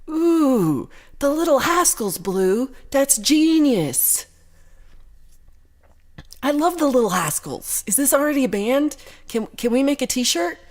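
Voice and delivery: high, loud gushing delight; squealing with real delight; thrilled. Real, not acted. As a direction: gush over the idea like a fan. Delighted by a viewer's band name.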